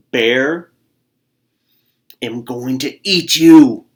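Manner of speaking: loud, slow bear roar; bear roaring threat. Acted. Bear about to eat the squirrel, loud slow roar. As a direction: bellow the threat down at him. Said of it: "Bear growled".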